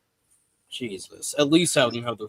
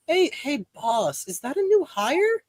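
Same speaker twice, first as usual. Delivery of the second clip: high coworker voice; 11 semitones higher than usual. Acted.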